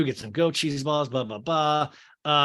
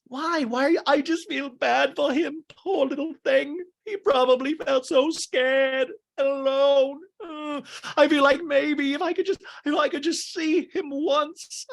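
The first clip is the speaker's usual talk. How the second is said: much higher, put-on whiny Raquel voice; mimicking Raquel; 11 semitones higher, 3 dB louder than usual. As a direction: gush and whimper like a lovesick girl. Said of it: "she's like".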